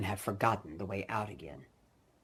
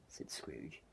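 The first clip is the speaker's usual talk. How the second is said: plain reading voice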